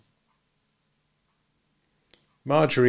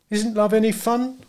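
put-on much higher, wounded young woman; hurt question; 10 semitones higher than usual. A narrator's reading. Marjorie asking Nick if love isn't fun, hurt. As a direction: ask it hurt.

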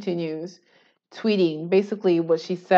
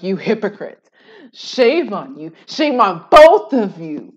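mock outraged Ethan; 7 dB louder than usual. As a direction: drag out the shame like a preacher. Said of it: "Ethan's like".

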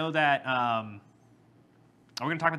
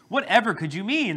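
higher, louder feigned puzzlement; mock innocence; 7 semitones higher, 5 dB louder than usual. Speaker retelling his coy reply in a sales pitch, mock innocent. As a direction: play innocent, pitched up for comic effect.

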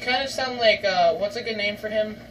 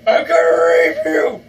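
loud, lowered threatening roar; aggressive gaming yell; 4 semitones lower, 6 dB louder than usual. Real, not acted. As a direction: roar it at your opponent. Threat yelled at game opponent.

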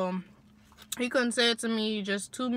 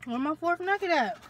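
surprised; 7 semitones higher than usual. Real, not acted. Missing nugget search.

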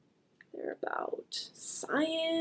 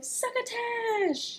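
drawn-out, higher excited call; excited yell; 9 semitones higher, much slower than usual. Acted. Hungry kid yelling the dish name, slow and excited. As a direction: stretch it out with glee. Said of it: "They're yelling".

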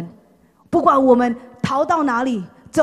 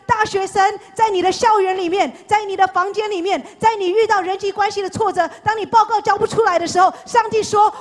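much higher and louder, impassioned declaration; full-force preaching; 9 semitones higher, 6 dB louder than usual. Acted. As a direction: proclaim it over the whole congregation. (激昂讲道)

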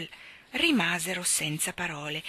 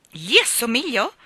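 loud, higher, offended question; resentful cry; 5 semitones higher, 8 dB louder than usual. A narrator's reading. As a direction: throw the question back indignantly. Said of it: "gridò risentito l'ometto".

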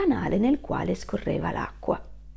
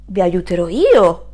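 louder, firm promise; confident wolf; 9 dB louder than usual. Acted. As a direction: declare it like a hero.

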